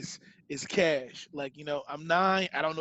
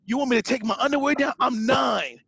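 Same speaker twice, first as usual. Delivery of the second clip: outraged; 4 semitones higher, 3 dB louder than usual. Retold or imitated. Outraged kid refuses to strip.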